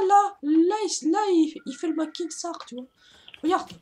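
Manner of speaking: ordinary talk, nothing marked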